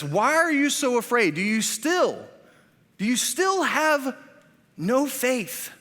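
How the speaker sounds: high, loud, slow "do you still have no faith"; Jesus rebuking disciples. Acted. Jesus questioning his frightened disciples, incredulous. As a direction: stretch it out in pained disbelief. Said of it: "He says".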